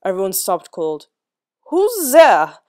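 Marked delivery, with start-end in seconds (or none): 1.7-2.6 loud, high startled demand. A narrator's reading. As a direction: snap the question into the dark. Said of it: "demanded Prussia".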